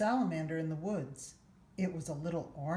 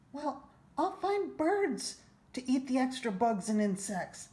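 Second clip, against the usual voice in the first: put-on higher, louder child voice full of plans; eager little boy; 6 semitones higher, 4 dB louder than usual. A narrator's reading. Boy tells his mother his plans, eager higher child voice. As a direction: dream it up out loud like an excited kid.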